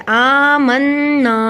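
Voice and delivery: "آمنا" slow, long aa and humming nn; ghunna. Shown as a demonstration. Teacher showing ghunna, "آمنا" with a held nasal n. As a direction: hold the doubled n of "آمنا" through the nose. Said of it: "پہ گنہ ہوا کیونکہ نون پہ شد ہے".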